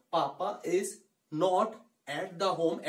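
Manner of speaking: spaced-out words with pauses; slowly, word by word. Shown as a demonstration. Teacher telling learners to go slowly, word by word. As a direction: speak it slowly, one word at a time. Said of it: "धीरे धीरे बोलना है आपको".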